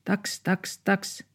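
high yappy barks; little dog barking. Acted. Small dog barks at two dogs outside, high yaps. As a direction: yap like a tiny dog. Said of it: "se haukkui".

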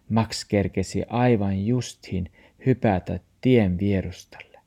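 ordinary delivery, nothing marked

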